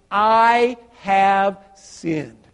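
very slow, weighty I have sinned; slow, solemn admission; slow confession. Acted. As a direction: draw out each word of the confession. Son rehearsing confession.

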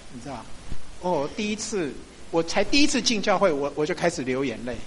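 normal talking voice

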